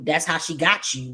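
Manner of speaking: ordinary delivery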